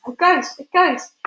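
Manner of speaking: loud, high cries of panic; yelling. A narrator's reading. Panicked crowd seeing an empty dressing gown, yelling. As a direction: cry out in alarm. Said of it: "with everybody yelling".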